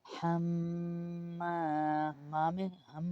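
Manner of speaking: حما with a nasal hum on meem; ghunnah on meem. Shown as a demonstration. Teacher isolates the nasal hum of meem. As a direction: hum the meem through the nose. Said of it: "ما کے میم میں غنہ ہوگا".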